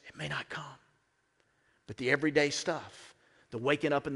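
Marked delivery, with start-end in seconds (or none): 0.1-0.6 hushed. Real, not acted. Hushed echo of the warning.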